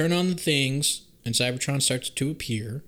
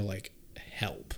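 whispered aside; whispering; 6 semitones lower, 14 dB quieter than usual. Real, not acted. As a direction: drop to a whisper.

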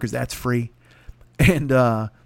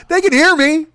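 much higher, louder declaration; loud taunt; 12 semitones higher, 7 dB louder than usual. Retold or imitated. Comic taunts the rich guys, much louder and higher. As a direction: say it so the whole table hears. Insult the table guys aloud.

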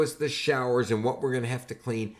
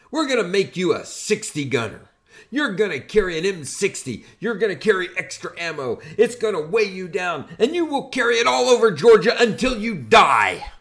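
higher and louder, building to a full shout; drill sergeant yell; 7 semitones higher, 6 dB louder than usual. Retold or imitated. Drill sergeant to big recruit.

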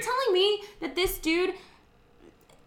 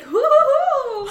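slow, high, louder hoots of joy; excited hooting; 10 semitones higher, 11 dB louder, slower than usual. Retold or imitated. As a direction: hoot like you just won. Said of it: "And Ryan's like".

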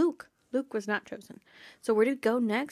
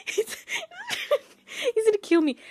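high, fast, through laughter; cracking up as she repeats it; squeaky laughing repeat; laughing hard; 13 semitones higher than usual. Real, not acted. Laughing at Percy's line.

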